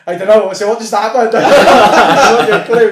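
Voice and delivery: high, loud, laughing through the words. Real, not acted. Retelling dazed post-fight confusion, laughing.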